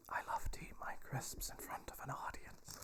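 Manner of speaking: hushed, unvoiced ASMR whisper. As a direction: whisper it softly, as if for an ASMR recording. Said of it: "A S M R crisp noises".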